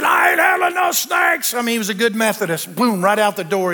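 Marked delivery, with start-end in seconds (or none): 0.0-1.5 high, slow, hammed-up yell of refusal; retold shout. Groomsman Bart fleeing the snake-handling wedding, mock yelling. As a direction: play the panicked friend bolting. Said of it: "He said".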